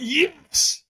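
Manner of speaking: YES pushed up high and strained; stronger yes. Retold or imitated.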